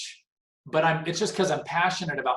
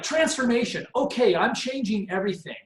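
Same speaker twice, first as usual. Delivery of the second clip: higher-pitched mock eagerness; gung-ho resolve; 7 semitones higher than usual. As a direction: play someone overhauling their whole life. Mimics an all-in reaction to transformation.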